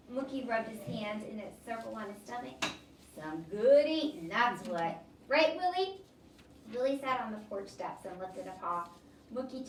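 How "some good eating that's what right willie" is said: put-on slow, louder old man savoring it; a drifter praising food to a dog; folksy old man. Mookie talks to the dog.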